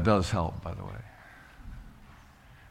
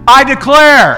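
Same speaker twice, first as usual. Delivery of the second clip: high, loud declaration for the church to echo; call-and-response leader, much higher and louder; preaching at full force; 11 semitones higher, 13 dB louder, slower than usual. Real, not acted. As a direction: proclaim it boldly. Leads declaration.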